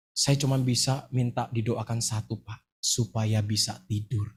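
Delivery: low, soft, slowing plea; imitating weary plea. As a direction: act out a sleepless mother asking humbly. Sleepless mother pleading for prayer.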